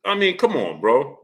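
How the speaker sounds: ordinary talk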